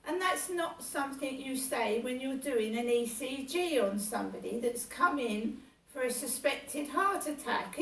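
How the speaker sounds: normal talking voice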